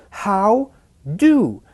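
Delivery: "how" and "do" punched high, each on its own; "how" and "do" isolated and strongly stressed; emphasized key syllables. Shown as a demonstration. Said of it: "Why are these syllables more emphasized?".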